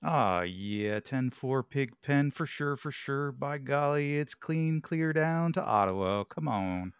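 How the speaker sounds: high, slow CB trucker drawl; hammy trucker on the radio; over walkie talkie. Pretend. CB radio reply as Pigpen.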